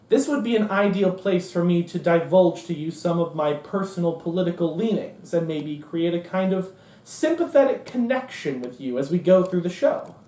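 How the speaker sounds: normal talking voice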